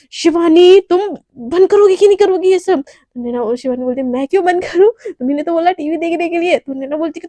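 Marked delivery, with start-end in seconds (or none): 0.1-2.8 high, loud furious shout; angry yell. Acted. Naina yells at noisy roommate. 4.0-6.6 loud cheeky comeback. Acted. Shivani argues back cheekily.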